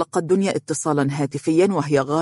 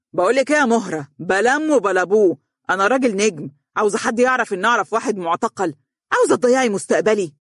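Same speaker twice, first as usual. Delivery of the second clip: higher, heated outburst; shouting, scolding; 5 semitones higher, faster than usual. A narrator's reading. Husband ends his wife's call, shouting at her. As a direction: cut her off with an irritated tirade. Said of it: "صاح بها".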